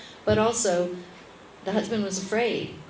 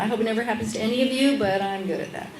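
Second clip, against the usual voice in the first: normal talking voice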